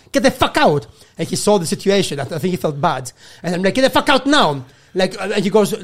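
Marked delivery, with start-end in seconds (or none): 0.1-0.9 higher, forceful retold command; angry order. Guest to intruding manager in his room, angry. 3.8-4.7 high, insistent retold demand; angry order. Guest repeating the order to hostel manager, angry.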